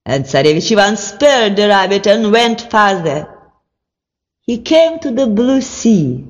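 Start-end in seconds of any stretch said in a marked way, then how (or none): none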